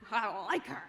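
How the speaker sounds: said through a real laugh. Real, not acted. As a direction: laugh out the fond admission. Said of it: "she's just really sassy".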